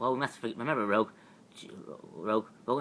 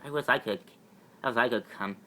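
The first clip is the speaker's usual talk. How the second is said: ordinary delivery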